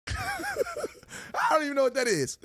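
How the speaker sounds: laughing it out, high and slow. Real, not acted. Vlogger laughing at a hater's label, laughing in disbelief. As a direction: giggle through the words in disbelief. Laughing at being called apologist.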